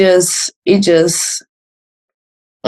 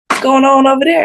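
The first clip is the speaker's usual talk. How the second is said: curious crowd; 8 semitones higher than usual. Retold or imitated. Crowd voiced wondering.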